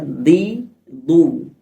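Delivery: "di, du" with Arabic dad from the tongue's sides; Arabic dad articulation. Shown as a demonstration. Teacher demonstrating the dad with vowels, side-of-tongue "di, du". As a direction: press the tongue's side against the molars for "di, du". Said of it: "keluar di pinggir kanan kiri lidah".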